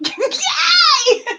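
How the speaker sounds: high, loud squeal with laughter; jubilant cheer. Real, not acted. Host told she guessed right, jubilant squeal. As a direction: shriek with delight.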